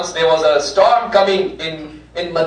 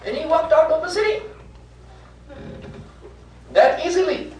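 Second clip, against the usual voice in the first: amazed telling; 13 semitones higher, 3 dB quieter, faster than usual. Real, not acted. Preacher marvels at Salman leaving.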